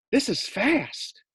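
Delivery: high, slow squeaky voice; small guinea pig voice; nervous little pet. Acted. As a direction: gasp it out while speeding. Fluffy in speeding truck.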